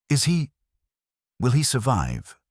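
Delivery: a German countess feigning worry; German accent in distress. A narrator's reading. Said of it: "the picture of aristocratic distress".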